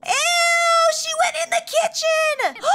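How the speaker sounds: high shrieking disgust; real grossed-out squeal. Real, not acted. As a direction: cry out in horror at the mess. Sim had an accident.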